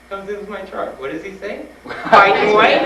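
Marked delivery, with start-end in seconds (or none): 1.9-2.8 high, very loud incredulous shout; hammed-up exasperated holler; mock outraged yell. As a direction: blurt it out as an absurd punchline. Mocking the doctor's question yet again.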